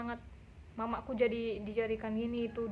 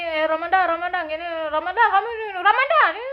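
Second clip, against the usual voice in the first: repeated pestering calls, higher and louder; nagging on purpose; 6 semitones higher, 8 dB louder than usual. Retold or imitated. Teasing a rich boy to drive him away, loud and nagging. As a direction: pester him by calling his name again and again. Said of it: "Gue gituin dia".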